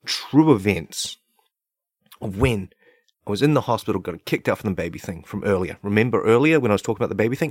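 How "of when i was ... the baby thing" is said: ordinary talk, nothing marked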